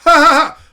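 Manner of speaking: loud high canned laughter; mock laugh-track burst; fake laugh track. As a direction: roar like a studio audience.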